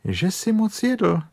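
high-pitched reproving rabbit voice; stern rebuke. Acted. Rabbit blames bear for overeating, stern high scolding. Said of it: "řekl králíček příkře".

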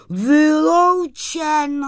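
high, loud, drawn-out reassurance; slow, ringing high-voiced assurance; assuring sprite. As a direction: dismiss all doubt in a high sprite voice. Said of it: "ubezpečoval ho skřítek".

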